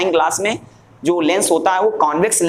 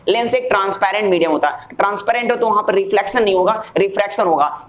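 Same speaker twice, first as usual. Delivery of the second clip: ordinary delivery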